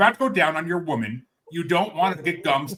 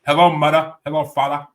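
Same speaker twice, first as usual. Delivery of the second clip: put-on Yiddish accent, louder; mock Yiddish accent; 7 dB louder than usual. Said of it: "there you go".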